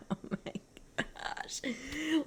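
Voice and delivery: whispered to herself. Real, not acted. Muttering a plan to herself over the puzzle, whisper. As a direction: breathe the words with no voice.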